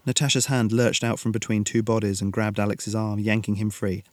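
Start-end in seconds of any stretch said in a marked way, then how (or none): none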